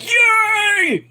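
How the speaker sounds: high, strained cheering; hammed-up jubilant yell; mock crowd cheer. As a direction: imitate a whole crowd going wild. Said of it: "everybody's like".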